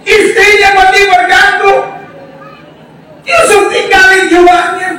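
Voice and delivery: high, urgent preacher's voice; full-force preaching. Acted. Preacher telling Joseph's story to the congregation, high and forceful.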